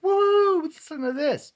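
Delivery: high-pitched whoop, acting out his own excitement; excited. Host mimicking himself sharing a find, excited. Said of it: "sometimes I get excited and I'm like".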